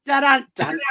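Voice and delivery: high, loud "dun dun dun"; hammy vocal drum sting; mock punchline sting. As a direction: punch out a joke's musical sting. Sung punchline sting after joke.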